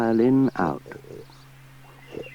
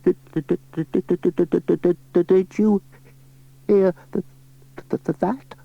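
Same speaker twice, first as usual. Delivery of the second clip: higher, frightened stutter; shaky stammer; 9 semitones higher, 4 dB louder than usual. Acted. Pat to Sarah under the blankets, terrified stammer. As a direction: stammer in terror in the dark. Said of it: "whispered in a shaky voice".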